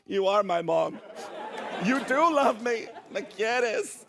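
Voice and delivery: high, hammed-up realisation of love; mock overwhelmed. Child to mom bringing Taco Bell, comically overcome. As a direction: pitch it up, comically moved.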